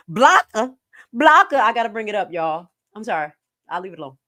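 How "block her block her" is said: imitating a loud, higher command to block her; mock order. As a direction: bark it out louder and higher each time. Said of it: "Like they said".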